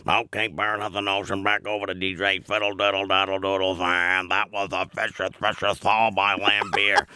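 low, gruff old sportscaster impression; Johnny Most impression. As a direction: do the old Celtics announcer, low and gravelly. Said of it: "It was just one of those".

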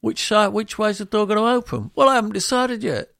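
high, loud acted back and forth; hammed-up frazzled dialogue; mock exasperation. Builder quizzing homeowner, high loud mock exasperation.

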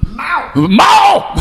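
high, loud yell of Mao; drinking-game shout in the Deer Hunter role; shouted game call. Acted. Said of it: "I always played the Vietnamese guy".

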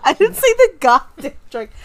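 high, loud laughing objection; indignant protest. Real, not acted. Girlfriend objects to his quote, loud indignant protest. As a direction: shout him down in playful outrage.

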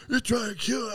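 high, strained panic, imitated; panicked self. Panicking to the neighbor during the high, high and strained. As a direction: squeak it out like you're scared for your life. His own panicked words quoted.

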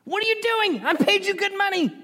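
high, exasperated royal complaint; outraged king. Acted. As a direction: scold the hired prophet like an outraged king. The king berates Balaam again.

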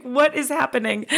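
high, baffled husband voice, lightly done; light impression of a puzzled husband asking; confused husband. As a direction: mimic the bewildered husband. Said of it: "It's like".

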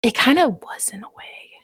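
breathy whispered awe, barely voiced; overcome whisper. Real, not acted. Friend whispering after a moving book passage, overcome. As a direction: whisper it, too moved to speak. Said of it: "I'm emotional".

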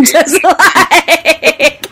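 breaking into laughter mid-sentence; trailing off into real laughter; laughing. Real, not acted. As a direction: laugh through the words. Laughing at the absurd casting argument.

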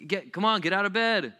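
exasperated parent. Acted. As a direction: urge a sleepy kid up impatiently. Parent loses patience.

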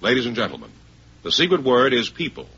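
ordinary delivery

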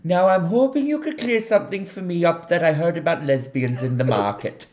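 high, loud Welsh character line; Welsh accent. Acted. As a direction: act out a film line in high-pitched Welsh. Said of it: "that line in the Welsh accent".

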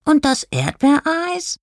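high, slow whiny question; sulky grumble. A narrator's reading. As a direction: whine it slowly in a high voice. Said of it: "maulte Oskar".

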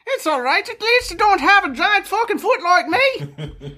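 put-on old woman voice.

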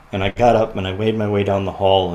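ordinary talk, nothing marked

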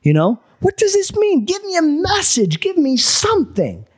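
high, strained shouted pleas; reenacted howl at God for a sign; yelling at God. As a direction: yell your frustration upward. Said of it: "yelling out to God or the universe".

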